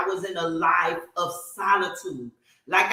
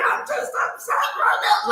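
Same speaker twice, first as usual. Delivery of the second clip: upset. Retold or imitated. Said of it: "and I'm like".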